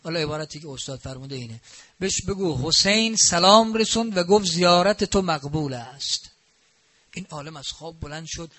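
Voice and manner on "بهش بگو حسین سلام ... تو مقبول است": loud, ringing message from the Imam; louder, fervent delivery of the Imam's message; fervent proclamation. Retold or imitated. Imam's dream message, retold.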